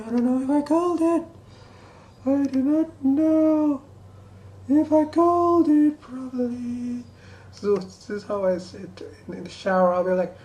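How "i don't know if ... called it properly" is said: squeaky cartoon mouse voice, much higher and louder; Mickey Mouse voice. Shown as a demonstration. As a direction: falsetto Mickey Mouse impression. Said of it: "how I practice my Mickey Mouse voice".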